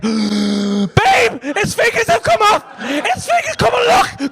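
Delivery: very high, frantic shout; excited shouting. Retold or imitated. Husband calls babe over to the window, frantic excited shout. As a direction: yell for someone to come and see. Calls partner to see severed fingers.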